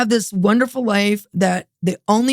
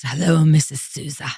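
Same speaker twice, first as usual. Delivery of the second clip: lower, smooth imitation of him; husband's phone greeting; 4 semitones lower than usual. Husband phoning future wife that morning, lowered imitated greeting. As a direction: greet her knowingly, voice dropped. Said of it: "he calls me that morning and he says".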